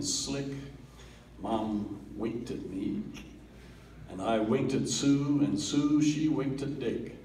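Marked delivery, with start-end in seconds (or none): none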